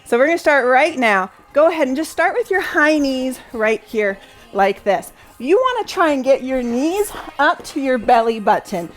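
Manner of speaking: upbeat workout coaching; aerobics instructor. Acted. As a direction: pump the class up, keep cueing. Leads workout interval live.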